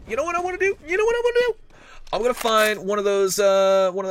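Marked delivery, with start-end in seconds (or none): 0.1-0.8 high squeaky question. Real, not acted. Caster builds to excited question. 0.9-1.6 shrill falsetto repeat, much higher; even higher squeal. Real, not acted.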